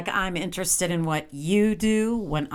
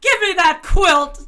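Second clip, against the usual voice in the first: loud furious demand; angry shout; 9 semitones higher, 9 dB louder than usual. Acted. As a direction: bark the order at him. Wife snaps at husband.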